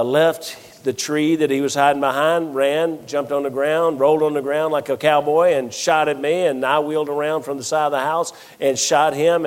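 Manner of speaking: usual speaking voice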